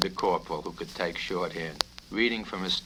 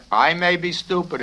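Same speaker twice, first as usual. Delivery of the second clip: cutting in, higher and louder; sarcastic interruption; 10 semitones higher, 9 dB louder than usual. Acted. Colonel cutting off the cadet, sarcastic and loud. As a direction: snap in before he finishes. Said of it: "interrupted the colonel".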